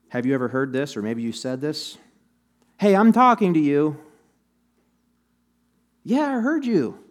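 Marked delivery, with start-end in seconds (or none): 2.8-4.0 loud, higher-pitched call for attention; acted scolding, raised voice; insistent demand. 6.0-7.0 high-pitched, put-upon comeback; acted protest, pitch jumping up; defensive retort. Acted reply to being scolded.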